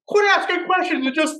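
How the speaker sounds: high, loud put-on yell; imitating an angry guy; mock angry yell. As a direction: bark it like the stranger. Angry stranger yelling, retold.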